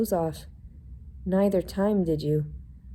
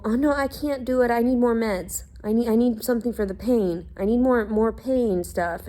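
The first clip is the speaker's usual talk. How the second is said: higher, whining imitation of her mother; mocking whiny mother; 5 semitones higher than usual. Mimicking her mother's complaints to others, mockingly whiny. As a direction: whine it like a complaining parent.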